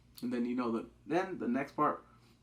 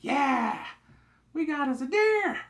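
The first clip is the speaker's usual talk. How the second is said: much higher, louder cheer; a hunter bragging about a deer; all happy; 13 semitones higher, 7 dB louder, slower than usual. Retold or imitated. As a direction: whoop about the catch.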